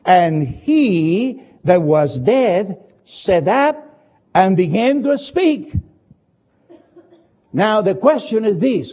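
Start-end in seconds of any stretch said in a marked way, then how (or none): 0.0-5.7 very slow, each word set apart; drawn-out reading of "sat up"; slowly. Shown as a demonstration. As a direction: savour every word. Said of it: "The Bible said in verse fifteen clearly".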